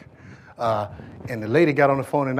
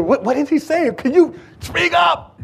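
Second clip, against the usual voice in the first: puzzled officer asking, higher pitch; straining listener; 12 semitones higher than usual. Retold or imitated. Officer at roll call straining to hear the chief, puzzled. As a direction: ask as if you can't make out a word. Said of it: "we're just trying to hear".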